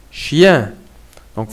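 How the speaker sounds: ordinary talk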